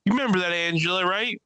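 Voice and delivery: high-pitched imitation of her; imitated woman comedian. Woman comic calling out her ex in the audience, imitated. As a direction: put on her voice, pitched well up. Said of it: "So she's like".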